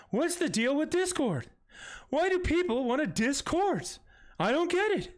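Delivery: high-pitched, exaggerated stand-up comic impression; Seinfeld. Shown as a demonstration. Streamer redoing a Discord joke for chat, hammed-up Seinfeld voice. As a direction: swoop way up into a bad sitcom-comedian voice. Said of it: "I had to do that in the Seinfeld voice".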